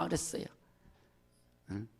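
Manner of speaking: usual speaking voice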